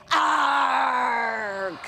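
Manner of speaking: hearty pirate arg. Acted. Pirate leads everyone in a hearty arg. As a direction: growl it like a pirate. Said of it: "Everyone say".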